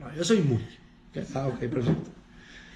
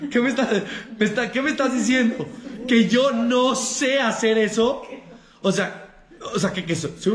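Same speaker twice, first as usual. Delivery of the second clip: indignant outburst, pitch up and louder; ofendido indignado; 5 semitones higher, 3 dB louder than usual. Acted. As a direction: take it as a personal insult, half laughing. Ofendido ante un reproche actuado. (indignado y ofendido, medio riendo)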